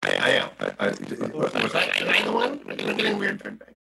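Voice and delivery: warbling, distorted call audio; muffled wah wah talk. Real, not acted. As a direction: voice garbled by line feedback. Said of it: "Still, still happening".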